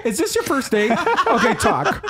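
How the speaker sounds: high, laughing mock researcher; laughing impression. Host laughingly imitates researcher instructing couples. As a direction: imitate him through laughter. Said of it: "he would walk around and say".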